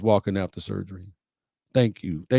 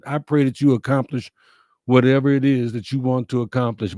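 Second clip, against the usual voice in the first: usual speaking voice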